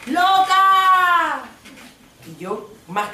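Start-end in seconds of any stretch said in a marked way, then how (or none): 0.1-1.4 curiosa escandalizada, aguda y fuerte; shocked bystander. Retold or imitated. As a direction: exclama con asombro. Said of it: "Y la gente". (la gente escandalizada)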